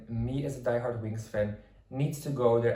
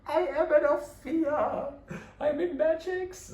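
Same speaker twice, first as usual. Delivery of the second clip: squeaky, much higher fangirl voice. Retold or imitated. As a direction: squeal it like a starstruck little girl. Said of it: "I was like".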